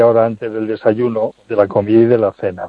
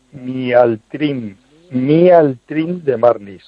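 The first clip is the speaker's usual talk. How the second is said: slow; much slower than usual. Shown as a demonstration. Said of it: "Mialtrim, ¿vale?".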